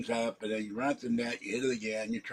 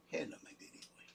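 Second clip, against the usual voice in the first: tender whisper, higher; whispering to cat; 6 semitones higher, 14 dB quieter, faster than usual. Real, not acted. Owner whispering hello to a favourite cat.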